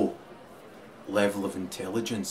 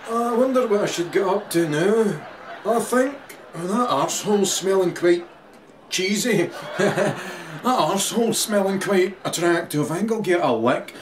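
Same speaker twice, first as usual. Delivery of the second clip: higher, louder silly dog musing; dog's inner voice; 7 semitones higher, 4 dB louder than usual. Retold or imitated. Dog deciding what to do next, hammed-up musing. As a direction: play a dog pondering its next treat.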